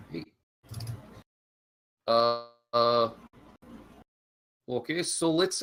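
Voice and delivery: ordinary delivery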